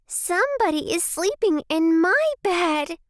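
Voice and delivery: very high, squeaky bear cub; small squeaky baby voice. A narrator's reading. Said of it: "said in his small, squeaky baby voice".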